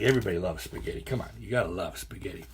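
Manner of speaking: plain reading voice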